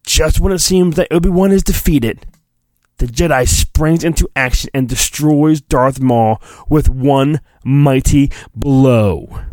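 ordinary delivery

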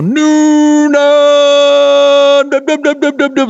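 announcer's yell. Retold or imitated. Host showing how the announcer ends a Nunaball game, long high mock yell. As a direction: imitate a soccer announcer's long victory call. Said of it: "he would yell".

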